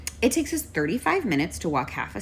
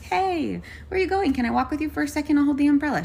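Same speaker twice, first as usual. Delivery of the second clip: high-pitched, eager helper's greeting; sweetly approach a stranger to offer help; friendly offer; 9 semitones higher than usual. Retold or imitated. Passer-by offering an old lady her umbrella, high and friendly.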